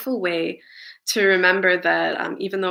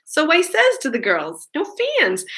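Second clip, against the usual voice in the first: put-on Celia's thick accent, pitched much higher; high-pitched imitation of a thick local accent; 9 semitones higher than usual. Singer imitating Celia from the factory interviews, thick accent. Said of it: "amazingly thick little accent".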